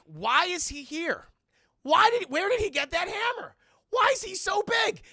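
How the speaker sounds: high, loud incredulous shouting; exasperated rant. Real, not acted. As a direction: yell each question in mock outrage.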